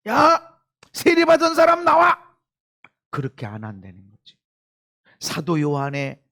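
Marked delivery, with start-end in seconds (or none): 0.1-2.2 high, loud calling-out; mock roll-call shout; loud summons. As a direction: call them forward at full voice. Acts out the Lord summoning people.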